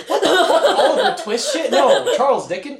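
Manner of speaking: high-pitched, loud laughing disbelief; cracking up mid-question. Real, not acted. Cracking up at the player's line.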